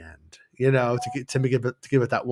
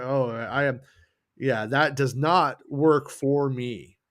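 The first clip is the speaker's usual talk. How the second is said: ordinary delivery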